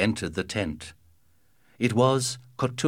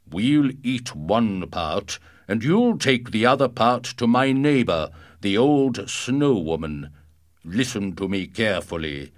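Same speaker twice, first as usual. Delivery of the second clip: booming male voice; 5 dB louder than usual. Acted. Giant gives orders, loud.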